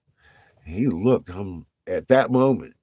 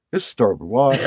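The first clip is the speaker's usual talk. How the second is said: louder, higher formal radio report; radio call; 6 semitones higher, 8 dB louder than usual. Retold or imitated. Sailor radioing in his contact to the bridge, formal and loud. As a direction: play it like an official watch report. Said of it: "I was like".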